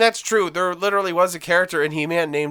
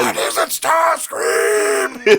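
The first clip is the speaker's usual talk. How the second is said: slow, much higher shouted robot leader; Megatron voice; 14 semitones higher, much slower than usual. Retold or imitated. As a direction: bark it like an annoyed robot tyrant. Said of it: "They did a lot of shrieking".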